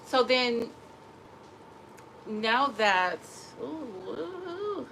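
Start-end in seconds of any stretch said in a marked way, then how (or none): 3.6-4.9 high breathy whimper. Real, not acted.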